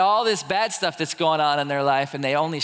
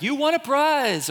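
high, slow game-show gush; mock cheerful prize announcer; cheesy announcer voice; 8 semitones higher than usual. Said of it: "to be like".

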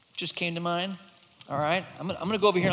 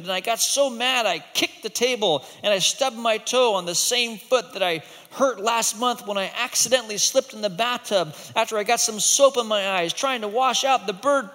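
put-on higher, breathless kid's woe; tearful rant; 6 semitones higher, 4 dB louder than usual. Boy complaining to the monster about everything. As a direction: pour out the whole list of troubles. Crying boy's long complaint.

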